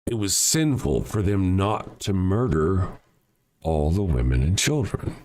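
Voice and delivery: slowed down, each word spelled out; slow it down for emphasis. Shown as a demonstration. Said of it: "I'll say it slower".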